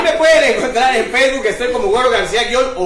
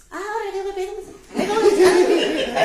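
much higher, slowed, squeaky mock voice; animal in the joke; 11 semitones higher than usual. (voz aguda y lenta de caricatura)

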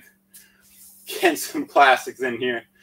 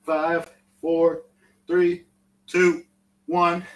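loud countdown; 6 semitones higher, 4 dB louder, much slower than usual. Real, not acted. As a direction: call out each number high and loud. Coach counting down the final reps.

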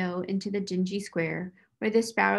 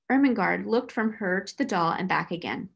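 plain reading voice

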